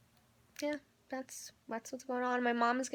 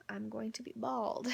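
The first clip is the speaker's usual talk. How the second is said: whispering; 7 dB quieter than usual. Real, not acted. Whispered aside about going bald.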